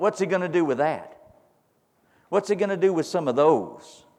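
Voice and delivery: high, slow, building preacher's challenge; rising preacher's cry. Real, not acted. Preaching at full force.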